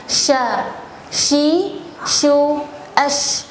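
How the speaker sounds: sha shi shu ash, sh airy and spread; over enunciating. Shown as a demonstration.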